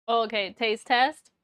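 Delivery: usual speaking voice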